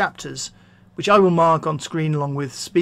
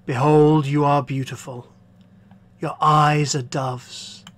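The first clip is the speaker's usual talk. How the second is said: slightly lowered, firm lover's voice; man in love; 4 semitones lower, slower than usual. Acted. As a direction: address the beloved with measured ardour.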